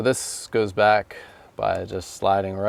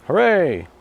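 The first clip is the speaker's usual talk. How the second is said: loud happy cheer; excited cheer; 9 semitones higher, 7 dB louder than usual. Real, not acted. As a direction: cheer at the fix. Door handle works.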